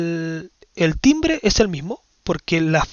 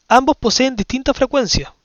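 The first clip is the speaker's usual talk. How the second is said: raised, louder reading of a quiz option; reading the option aloud; 5 semitones higher, 4 dB louder than usual. Shown as a demonstration. Teacher reads the test option to her class, raised and louder. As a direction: read the option out to the class, a little higher and louder.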